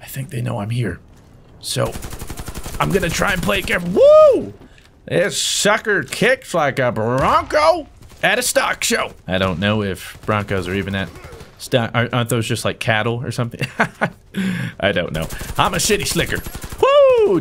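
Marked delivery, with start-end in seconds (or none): 3.9-4.5 excited whoop. Real, not acted. Recoil excitement. 5.1-9.1 lightly voiced cowboy, slow; hint of a Western drawl. Cowboy simile. 16.8-17.4 excited whoop. Real, not acted. Two kills.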